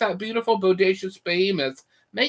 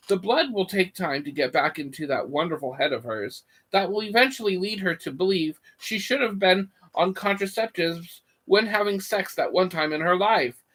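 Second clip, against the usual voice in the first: normal talking voice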